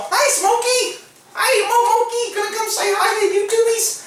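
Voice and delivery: very high, cooing call to a cat; sing-song high-pitched pet voice; pet talk. Real, not acted. Calls his cat to camera.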